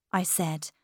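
plain reading voice

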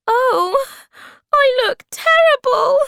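very high, loud wail; squealing storybook maiden in distress; distressed cry. A narrator's reading. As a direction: cry out in horror at your own looks. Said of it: "she cried".

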